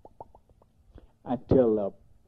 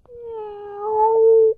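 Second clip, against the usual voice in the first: high, louder mewing cry; kitty meow; 5 dB louder than usual. Acted. A kitten mewing to be let in at the door.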